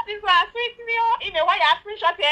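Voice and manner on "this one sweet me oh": laughing through the words; real laughter. Real, not acted. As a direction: crack up while speaking. Laugh heard.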